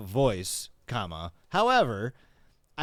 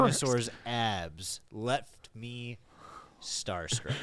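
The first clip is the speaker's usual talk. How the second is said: slow dramatic read; 2 semitones lower, 3 dB quieter, much slower than usual. Acted. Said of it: "Say that again, but slower".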